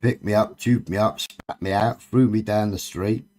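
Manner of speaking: ordinary talk, nothing marked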